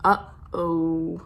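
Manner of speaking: low, drawn-out "Uh-oh"; ominous uh-oh. Acted. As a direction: let the trouble hang in a low voice. Reader foreshadows trouble.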